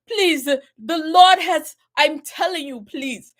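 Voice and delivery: put-on loud, begging in a thick African accent; pleading African accent. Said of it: "he had a really thick African accent".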